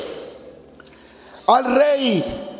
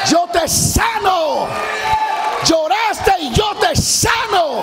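God's proclamation; 7 semitones higher, slower than usual. Acted. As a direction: proclaim healing boldly. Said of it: "Y le dice". (proclamación divina, aguda y lenta)

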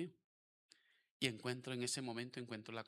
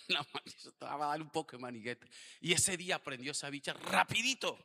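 ordinary talk, nothing marked

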